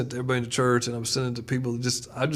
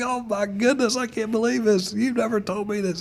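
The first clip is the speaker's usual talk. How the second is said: much higher, excited disbelief; stunned amazement; 10 semitones higher, 4 dB louder than usual. Retold or imitated. Coworker reeling from the pastor news, high and amazed. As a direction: gush like the news floors you. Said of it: "And he's like".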